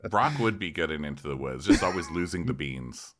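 ordinary talk

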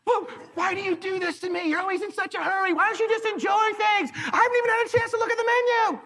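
high, whiny complaining wife. Retold or imitated. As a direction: whine like an impatient wife. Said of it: "Here it comes".

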